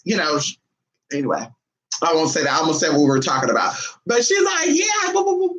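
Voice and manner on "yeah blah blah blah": very high imitation of someone babbling; mock chatter. Cousin chatting away, high mock chatter. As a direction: rattle off high, mimicking filler talk.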